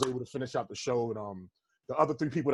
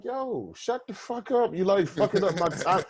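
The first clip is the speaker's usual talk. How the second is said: higher, exasperated telling-off; retold angry outburst; 5 semitones higher, 4 dB louder, slower than usual. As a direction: retell snapping at a heckler, pitched up. Retelling his outburst at a heckler.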